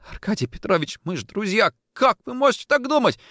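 high, pleading voice rising to the end; desperate plea. Acted. Orlov to his boss as old friends, desperate plea.

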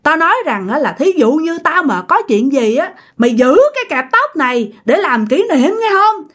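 high-pitched, gloating ghost confession; acted spirit's menacing reveal; vengeful taunting. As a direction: taunt her with your secret curse, pitch soaring. Spirit reveals hairclip haunting.

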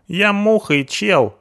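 hoog, rauw punkersstemmetje, spottend; punk sidekick voice. Acted. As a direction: speel de brutale punker, veel hoger.

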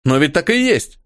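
high eager exclamation; exclaiming. A narrator's reading. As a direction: burst out, sure of it. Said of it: "воскликнул Кхали".

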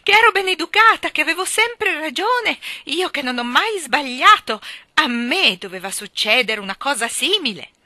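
loud, high indignant outburst; outraged high-pitched protest; shouting. Acted. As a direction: rail at the injustice from the cage. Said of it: "picchiava sulle sbarre e gridava".

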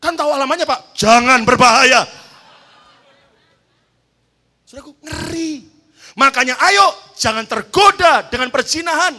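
loud, high preaching; fiery warning. Real, not acted. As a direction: warn the church with force. Preaching at full force. (peringatan keras berapi-api di mimbar)

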